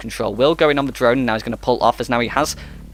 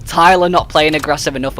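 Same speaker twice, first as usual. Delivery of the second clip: higher, louder live commentary; excited play-by-play; 5 semitones higher, 5 dB louder than usual. Acted. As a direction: push the call up as the clock runs out. Strained live play-by-play, climax.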